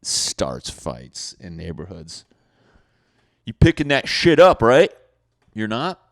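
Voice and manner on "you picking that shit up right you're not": loud, high demanding yell; angry yell. Retold or imitated. Neighbor yells at dog walker, loud angry demand. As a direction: order him to pick it up.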